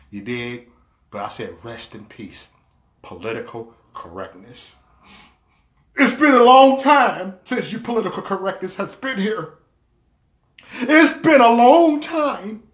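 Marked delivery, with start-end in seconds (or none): 1.6-4.7 low, very soft solemn send-off; mock eulogy. Repeats the mock-mourning status. 5.9-12.6 high, declaimed goodbye; mock farewell. Mock farewell to political correctness.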